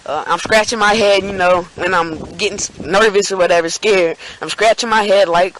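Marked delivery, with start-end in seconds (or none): none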